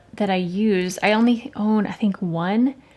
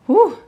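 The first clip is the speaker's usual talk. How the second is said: relieved whew; 8 semitones higher, 5 dB louder than usual. Real, not acted. Relief after painting a swatch.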